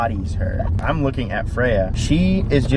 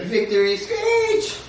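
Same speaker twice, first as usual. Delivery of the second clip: much higher, slow squeaky cat voice; voicing the cat; slower than usual. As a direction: play the cat gloating. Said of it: "she's like".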